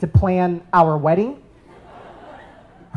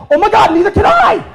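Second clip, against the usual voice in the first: much higher and louder, laughing delight; mock thrilled; 11 semitones higher, 6 dB louder than usual. Telling his friend he's thrilled about Palestine, high and laughing. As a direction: gush it with a laugh. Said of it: "And I was like".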